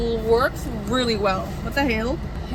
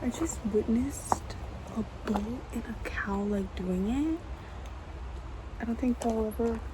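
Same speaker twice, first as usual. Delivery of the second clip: hushed, much softer whisper; 9 dB quieter than usual. Real, not acted. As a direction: whisper so nobody nearby hears. Whispering about cows.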